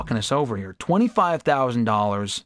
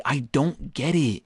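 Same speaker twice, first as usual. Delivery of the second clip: normal talking voice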